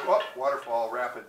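ordinary delivery

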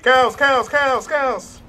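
yelling cows. Real, not acted. Sees cows in the road.

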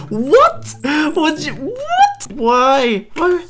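very high, squeaky disbelief; incredulous shriek. Real, not acted. Streamer reacting to a friend firing a gun, incredulous squeal. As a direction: squeal it in utter shock. Reacting to shooting story, shocked.

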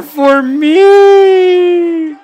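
high, loud, slow challenge; hammed-up wrestler challenge. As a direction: roar it like a showman wrestler.

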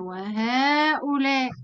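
وهؤلاء said slowly, long aa after the ha; drawn-out demo word. Shown as a demonstration. Teacher listing demonstratives for students, slow. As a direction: stretch وهؤلاء to show the alif. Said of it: "وإيه كمان؟".

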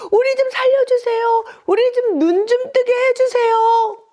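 very high, loud desperate plea. Acted. Blind men defying the crowd to beg Jesus, full high shout. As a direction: cry out at the very top of your range. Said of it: "더욱 큰 소리로 예수님께 외쳤어요".

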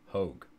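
Hogue said as "hohg", rhymes with rogue, firm falling close; decide on Hogue and land it flat; settled pronunciation. Shown as a demonstration. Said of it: "I'm gonna go with Hogue".

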